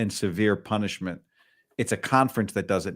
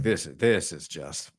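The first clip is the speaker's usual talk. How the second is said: lightly acted sober broadcaster, lower and measured; restrained on-air disapproval, as if calling the game; a hint of grave commentator; imitated game announcer; 4 semitones lower, 4 dB quieter than usual. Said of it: "if I were doing the game with the headset on".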